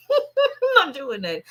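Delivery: amused no, laughter in the voice; laughing refusal. Real, not acted. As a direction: say it through a laugh, shaking your head.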